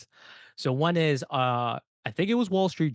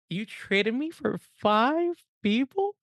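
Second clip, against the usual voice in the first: much higher, drawn-out, slow disbelief; incredulous disbelief; 9 semitones higher, much slower than usual. Retold or imitated. Soldier to his sergeant, higher and slow in disbelief. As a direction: stretch it out, stunned he'd been swapped like that. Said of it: "And I'm like".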